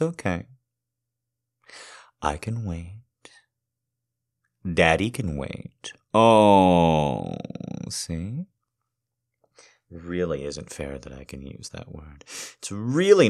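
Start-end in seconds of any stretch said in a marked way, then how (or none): none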